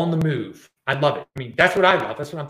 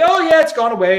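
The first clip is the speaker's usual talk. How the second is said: mocking quote; 12 semitones higher, 5 dB louder than usual. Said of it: "They'll be".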